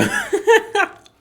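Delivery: loud, high-pitched burst of laughter; real laugh. Real, not acted. Viewer reacting to a mean little joke, laughing out loud.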